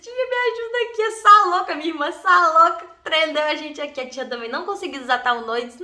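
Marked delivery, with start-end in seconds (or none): 0.0-4.0 put-on high, loud childish plea for help; pleading child. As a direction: whine to your aunt like a stuck kid. Kids begging the aunt for help.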